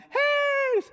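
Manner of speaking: sudden high loud shout. Acted. Student calling out across campus, loud and high.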